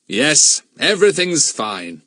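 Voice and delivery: hearty reassurance. Acted. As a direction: reassure the excited children cheerfully. Bill answers the children heartily.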